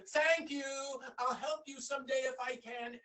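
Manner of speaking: a happy little bee promising to help; grateful bee. Acted. Bee thanks the young man, high and bright.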